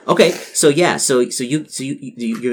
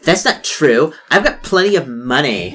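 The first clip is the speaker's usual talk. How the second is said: higher, louder protest; defensive denial; 5 semitones higher, 5 dB louder than usual. Acted. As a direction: insist she's rich. Same denial again.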